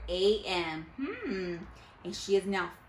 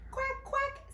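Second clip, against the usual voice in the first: high, playful quacking; duck quack. Acted. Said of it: "said the duck".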